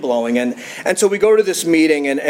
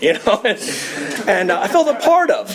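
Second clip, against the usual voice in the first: laughing; 5 semitones higher than usual. Real, not acted. Laughing at own memory.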